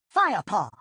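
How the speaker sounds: loud, sharp growled call; growled name. A narrator's reading. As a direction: bark his name in alarm. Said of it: "growled Whitestorm".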